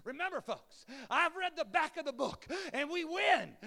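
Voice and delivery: loud, high booming revival evangelist; old evangelist. Retold or imitated. Pastor imitating Arkansas evangelist Don Brankel, loud. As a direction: boom it out like a tent-meeting evangelist. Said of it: "he would say things like this".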